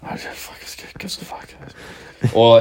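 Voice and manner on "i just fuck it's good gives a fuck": exhausted, barely voiced whisper. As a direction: mutter it under your breath, defeated. Retelling numb resignation in bed.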